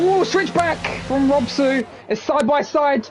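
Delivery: suddenly high, excited race call. Real, not acted. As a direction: call the overtake with rising excitement. Excited call of a close battle.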